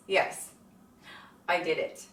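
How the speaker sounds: ordinary talk, nothing marked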